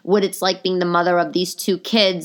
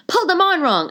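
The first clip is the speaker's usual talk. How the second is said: much higher squeaky repeat; mock embarrassed excuse; 12 semitones higher, 4 dB louder than usual. Girlfriend excusing squeaky leggings, mock embarrassed. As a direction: push the lame excuse up high.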